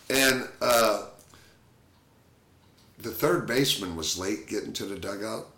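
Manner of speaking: ordinary delivery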